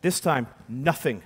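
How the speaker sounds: usual speaking voice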